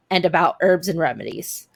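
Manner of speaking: ordinary talk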